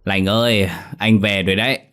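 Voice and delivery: put-on drunken slurred calling. A narrator's reading. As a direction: slur it like a drunk. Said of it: "giọng lè nhè say mềm".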